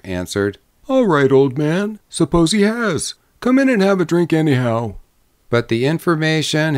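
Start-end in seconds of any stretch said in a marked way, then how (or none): 0.9-4.9 higher, breezy joking drummer; innocent, jocular. A narrator's reading. Drummer answering the young man's warning, jocular. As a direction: brush it off with jolly innocence. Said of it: "innocent and jocular".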